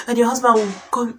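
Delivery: ordinary delivery, nothing marked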